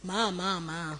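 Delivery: soft, bleated baby-like mock sobs; imitated crying. Newscaster recalling crying at the commercial break, mock crying. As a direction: imitate your own crying, low and small. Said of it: "I was crying like a baby".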